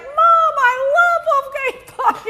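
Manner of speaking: put-on high, slow, gushing boy's voice; excited kid son. Mother voicing her son's delight at the restaurant, high and gushing.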